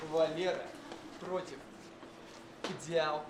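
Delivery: ordinary talk, nothing marked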